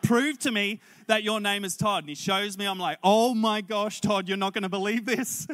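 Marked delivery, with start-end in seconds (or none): 0.0-2.0 high, loud challenge; insistent demand. Retold or imitated. Preacher demanding his seatmate prove his name, loudly insistent. 3.0-5.5 loud, raised-pitch amazement; astonished exclamation. Retold or imitated. Preacher seeing Todd's licence and telling him, loudly amazed.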